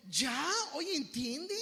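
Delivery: loud, impatient reprimand; acted "get it already" firmness; exasperated rebuke. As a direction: tell them off for not understanding. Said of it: "es como diciendo".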